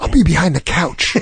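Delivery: impression jumping up in pitch for the payoff; Josh. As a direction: blurt the punchline as the awkward joke-teller. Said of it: "this is how he talks".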